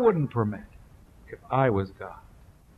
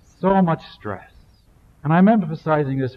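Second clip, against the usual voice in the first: louder, building sermon delivery; fervent preaching; 4 semitones higher, 7 dB louder than usual. Acted. Preacher warning the congregation, voice loud and driving. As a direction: preach it out with weight.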